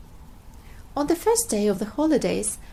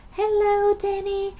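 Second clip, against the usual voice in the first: high, loud happy greeting shout; 10 semitones higher, 4 dB louder than usual. Acted. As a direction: call out to a friend on the mountain. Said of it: "shouted Peppa".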